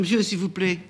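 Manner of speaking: high, loud call after him. Acted. Amanda calls after the departing ice-cream seller, shouting. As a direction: shout to stop him leaving. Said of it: "Amanda lui crie".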